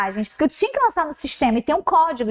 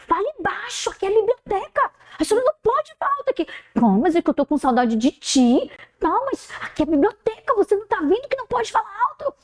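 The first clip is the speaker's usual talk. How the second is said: scolding student; 7 semitones higher, faster than usual. Retold or imitated. Student scolds teacher in library.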